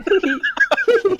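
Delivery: high, hammed-up fake giggle; fake laugh. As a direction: mimic a creepy forced laugh like the stranger. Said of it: "as he was fake laughing".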